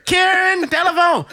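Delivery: high shouted call. Retold or imitated. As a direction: yell Karen across the house. Calling sister to phone.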